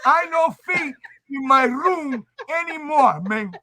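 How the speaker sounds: put-on broken English Cuban accent; stubborn Cuban dad voice. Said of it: "What happened to the Cuban accent?".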